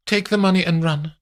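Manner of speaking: high voice, sly; scheming lady. Acted. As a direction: savour the secret, then toss it off. Amandine scheming.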